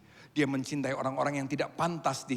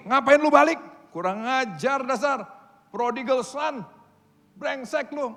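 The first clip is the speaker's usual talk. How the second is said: high, loud scolding tirade; hammed-up furious dad telling off his son; mock angry father; 12 semitones higher, 11 dB louder than usual. Father the preacher says did not act this way, shouting in mock fury. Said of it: "Nggak pakai gini".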